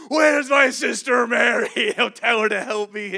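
loud, high indignant shout; angry shout. Retold or imitated. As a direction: storm in yelling. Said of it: "like a grizzly bear".

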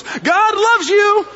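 high, loud and slow, every word stretched out; loud slow proclamation. Retold or imitated. Brian calls back to Carol while jogging, a loud drawn-out shout. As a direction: belt it out as a joyful blessing. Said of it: "And he just goes".

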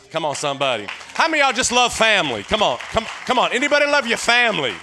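fast, loud call to the congregation; rousing preaching. Acted. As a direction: whip up the crowd.

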